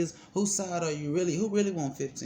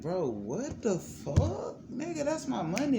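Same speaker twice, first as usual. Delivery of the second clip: outraged disbelief; 12 semitones higher, slower than usual. Retold or imitated. Outraged reply to lawyer.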